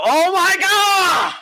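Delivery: high, loud, drawn-out yell of disbelief; ecstatic shout. Retold or imitated. Fan jumps up in a restaurant, ecstatic shout. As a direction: leap up and scream it.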